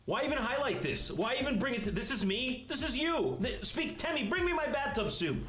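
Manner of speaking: mock hype. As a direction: oversell it like an ad. Mock hype over robot.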